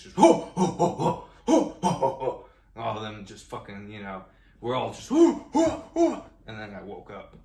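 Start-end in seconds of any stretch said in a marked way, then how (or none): 0.2-2.5 loud, high bursts of chimp hooting; monkey shout-off. Retold or imitated. Chimp defends his bananas from monkeys, shouted hoots. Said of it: "I'm having this shout off with these monkeys". 5.1-6.2 very high, loud chimp whoops; monkey shout-off. Retold or imitated. All the monkeys hooting at each other, shouted whoops. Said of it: "I'm having this shout off with these monkeys".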